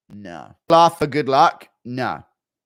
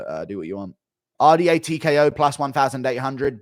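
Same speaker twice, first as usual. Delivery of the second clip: normal talking voice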